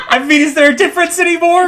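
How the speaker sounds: high, loud, cracking up mid-sentence; laughing. Real, not acted. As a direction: struggle to talk through laughter. Breaks up laughing at own joke.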